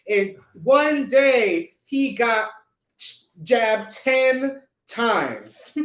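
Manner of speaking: slow, drawn out, each word hit hard; slow emphasis. Real, not acted. Telling listeners the man's feat, slow emphatic disbelief. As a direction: land the punchline slowly, stretching jabbed ten times. Punchline of incredulous story.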